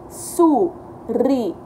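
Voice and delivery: Suricato drawn out slowly; slow counting read. Shown as a demonstration. Teacher helping kids count syllables. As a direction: stretch it so they can count syllables. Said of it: "Vamos contar quantas sílabas tem?".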